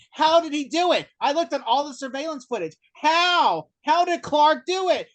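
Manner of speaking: high, loud, incredulous mock rant; obsessed. Doctor ranting to his orderlies about Clark, obsessed. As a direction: demand an explanation, pitched right up. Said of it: "he's probably obsessed about that".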